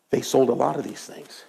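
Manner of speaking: ordinary talk, nothing marked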